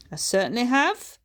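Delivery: firm Scottish yes; Scottish accent. A narrator's reading. Bertha confirms her vision in a firm Scottish accent.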